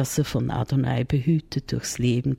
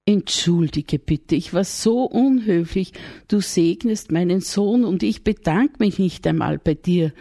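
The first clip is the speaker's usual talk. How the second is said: a young mother voiced a little higher and louder, sorry; Maria apologizing; 4 semitones higher, 5 dB louder than usual. Maria's voice in the story.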